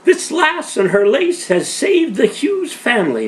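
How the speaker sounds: high, loud, slow call of a grateful neighbour; old Irish woman calling out. Acted. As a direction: proclaim it to the whole room. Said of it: "Missus O'Flaherty called out".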